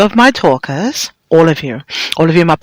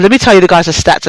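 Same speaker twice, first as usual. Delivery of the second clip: normal talking voice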